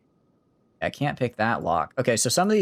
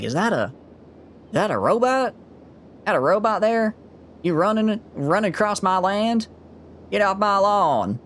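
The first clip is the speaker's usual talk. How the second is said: put-on southern accent; 11 semitones higher than usual. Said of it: "brought out my southern accent there".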